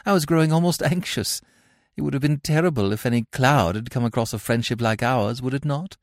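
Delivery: a hint of a gushing Victorian lady; lightly voiced haughty lady. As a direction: play a grand lady being charming. Gwendolen voiced in read play.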